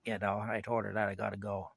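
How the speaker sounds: ordinary talk, nothing marked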